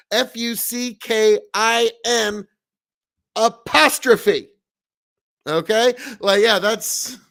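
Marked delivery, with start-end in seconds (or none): 0.1-4.5 letters F U C K I N said slowly, one by one; spelled out. Shown as a demonstration. Streamer spelling the word for chat, slow and deliberate. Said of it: "There's no G at the end".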